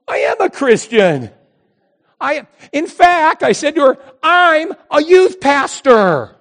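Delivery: high, loud, slow insistence; insistent. Retold or imitated. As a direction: drive home that you're a pastor. Insisting loudly he's a pastor.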